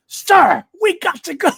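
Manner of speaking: hammed-up soldier hurrying his boss; imitating a sergeant shouting at his shell-shocked superior; mock urgent shout. Voicing Mosk urging Cyril.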